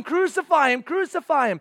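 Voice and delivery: imitated crowd chant, high and loud; retold crowd shout. Neighbor quoting the crowd, an imitated shout. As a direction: call it out as the retold mob. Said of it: "but they were shouting".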